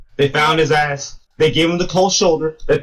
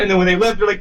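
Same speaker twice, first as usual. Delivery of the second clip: usual speaking voice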